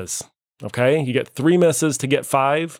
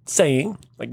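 ordinary talk, nothing marked

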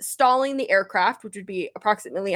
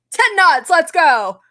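loud, higher barked boat command; mock captain shout; 4 semitones higher, 7 dB louder, much slower than usual. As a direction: call it out like a captain at the wheel.

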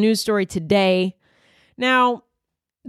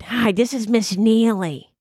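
Miss Neely's voice; 3 semitones higher than usual. Retold or imitated. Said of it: "That's how she sounded".

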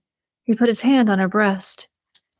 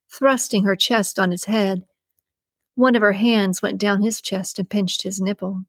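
ordinary delivery

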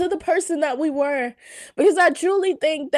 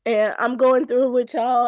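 usual speaking voice

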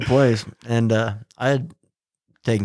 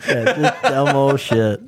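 slow, higher, laughing drawl; laughing agreement; 5 semitones higher, slower than usual. Real, not acted. Agreeing the fish funeral was silly, slow laughing. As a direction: drag it out while laughing.

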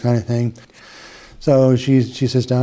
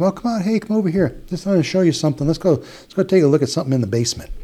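higher, coaxing lure of the abuser; 6 semitones higher, faster than usual. Retold or imitated. Abuser calling the girl away from the party, coaxing and higher. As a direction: sweet-talk her, pitched up, into coming along. Abuser voiced luring a child.

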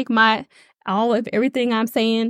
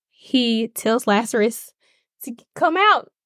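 usual speaking voice

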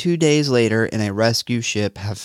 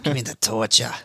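put-on New York accent. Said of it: "sound New York".

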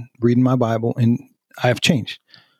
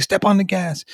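higher-pitched retold urging; urgent order; 7 semitones higher than usual. Dad to daughter putting along in traffic, urgent. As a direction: retell an exasperated driving instruction, pitch raised.

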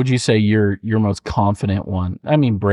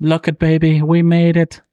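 higher-pitched Irish fighter impression; Conor McGregor; 6 semitones higher, 3 dB louder than usual. Best accent on request, Irish fighter impression. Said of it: "Like Conor McGregor?".

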